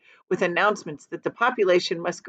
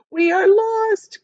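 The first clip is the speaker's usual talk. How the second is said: high, loud and slow wail of doom; despairing cry; 13 semitones higher, 6 dB louder, slower than usual. Acted. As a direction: cry out that all is lost. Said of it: "cried the ladybug".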